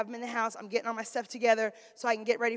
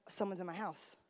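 hushed alarm; 6 semitones lower, 15 dB quieter than usual. Retold or imitated. Realizing an intruder.

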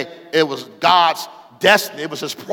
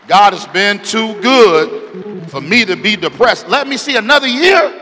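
full-voiced testimony, stretched and soaring; exultant preaching shout; 4 semitones higher, 9 dB louder than usual. Real, not acted. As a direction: cry it out to the whole church. Preaching climax, exulting to congregation.

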